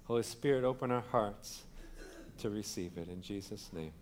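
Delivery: bow your head and pray softly before the word; prayer. Real, not acted. Pastor praying over the congregation before the sermon, soft and reverent.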